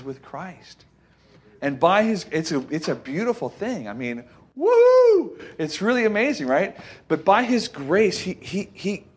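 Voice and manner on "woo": high, loud, long "woo!"; excited whoop. Real, not acted. Preacher overcome by a beautiful thing, excited whoop. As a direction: let out a sustained joyful whoop.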